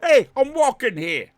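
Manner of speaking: high, loud mock New Yorker; hammed-up New York accent, shouted high. Streamer echoing co-host, loud exaggerated New York accent. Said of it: "judge my New York accent".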